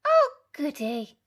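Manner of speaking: put-on high loud pleased old lady; granny thrilled for breakfast; high happy old lady voice; delighted granny. A narrator's reading. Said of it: "said Granny".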